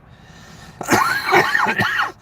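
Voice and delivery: hacking smoker's cough; harsh coughing fit. Real, not acted. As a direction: cough hard after a hit.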